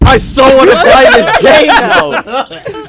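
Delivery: high, loud, drawn-out gush through laughter; gushing excitement. Acted. Host reacts to the game title in the marketable voice, loud laughing excitement. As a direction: play up being instantly sold, loud and high.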